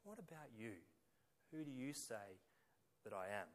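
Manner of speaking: soft, searching question to the congregation; quiet personal question. Shown as a demonstration. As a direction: lean in and ask softly, one to one. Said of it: "Forget about them out there for a minute".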